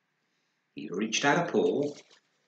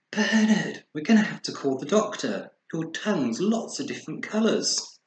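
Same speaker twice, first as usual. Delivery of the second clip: higher, louder, urgent worry; alarmed mother; 7 semitones higher, 5 dB louder than usual. Acted. Mother urgently tells her bear cub she'll call the doctor, high and loud. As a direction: sound the alarm to her child. Worried mum calling doctor.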